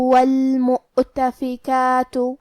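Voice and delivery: slow chanted وَالْمُؤْتَفِكَاتُ with a clear hamza stop and long "kaa"; measured tajweed recitation of وَالْمُؤْتَفِكَاتُ, long alif after ك; two-count lengthening. Shown as a demonstration. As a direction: stop briefly on the hamza of وَالْمُؤْتَفِكَاتُ, then stretch the كَا for two beats. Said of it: "دو مد کے برابر لمبی کریں گے".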